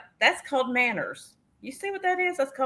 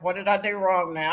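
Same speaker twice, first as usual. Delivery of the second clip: usual speaking voice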